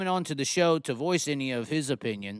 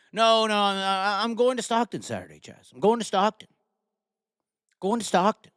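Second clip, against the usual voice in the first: imitating Joe; 6 semitones higher than usual. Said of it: "And I was like".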